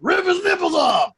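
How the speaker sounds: mock-savage shout; hammed-up yell. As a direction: yell it like a rabid sideline coach. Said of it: "And then I yell".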